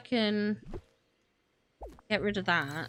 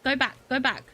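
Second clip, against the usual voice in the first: ordinary talk, nothing marked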